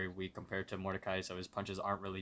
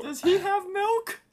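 put-on very high, slow, crying child voice; whiny kid; 9 dB louder than usual. Thirsty son whines to daddy about the man, very high and crying. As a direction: whine like a thirsty little kid on the verge of tears. Kid asks father in park.

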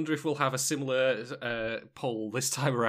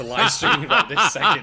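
laughing; 4 semitones higher, 12 dB louder than usual. Real, not acted. Laughing loudly while talking.

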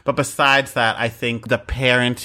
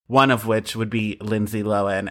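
usual speaking voice

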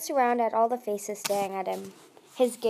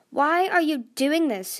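higher, louder challenge; demanding; 6 semitones higher, 7 dB louder than usual. A narrator's reading. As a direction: demand an explanation. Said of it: "Tsunami demanded".

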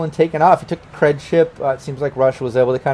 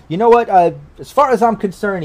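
lightly voiced official, pitched up; higher, easygoing official waving it through; Rainey, agreeable official; 7 semitones higher than usual. Official waves off the paperwork, lightly voiced, higher. Game master voices a character.

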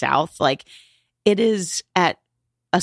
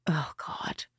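barely voiced, much softer dread; real hushed dismay; uneasy whisper; 11 dB quieter than usual. Real, not acted. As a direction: whisper it under your breath, unsettled. Uneasy reaction to last footage.

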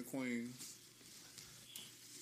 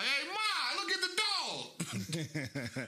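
put-on Boston accent; 11 semitones higher, much slower than usual. Boston guy in a commercial showing his mom the dog, Boston accent. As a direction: yell Hey Ma, then stretch the rest in a Boston accent. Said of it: "'Cause I seen the commercial like".

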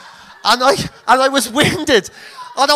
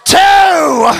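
loud, higher, drawn-out count; referee's count; 5 semitones higher, 6 dB louder than usual. Retold or imitated. Referee counting out a downed boxer, loud mock count. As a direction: call it out like a referee. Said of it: "And then he goes".

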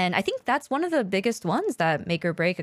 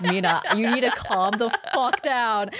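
laughing, slightly raised mock scolding; mock exasperation; 3 semitones higher than usual. Friend telling co-host to stop obsessing over numbers, laughing exasperation.

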